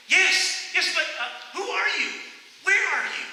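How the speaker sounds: high, loud, anxious calling; scared man shouting up to an unseen voice; panicky questions. Acted. Jack on the cliff asks who is speaking, panicky shout.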